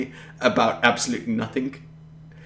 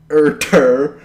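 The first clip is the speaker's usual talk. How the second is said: louder dopey American grunt, mocking; loud American impression; 4 semitones higher, 9 dB louder than usual. Mocking how Americans sound to him, loud dopey grunt. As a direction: bark it like a dumb American. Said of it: "That's your impression of Americans".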